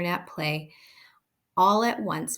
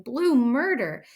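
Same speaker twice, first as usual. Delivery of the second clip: screaming; 6 semitones higher, 4 dB louder than usual. A narrator's reading. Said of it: "Amanda was screaming".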